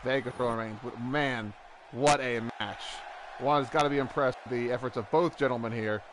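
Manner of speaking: excited play-by-play call; wrestling commentator. Acted. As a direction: call the match like ringside. Play-by-play of the match.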